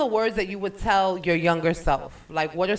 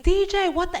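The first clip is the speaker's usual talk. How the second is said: high, indignant call to the DJ; outraged at the DJ; 9 semitones higher, much faster than usual. Retold or imitated. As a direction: demand to know why the music stopped. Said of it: "and I'm like".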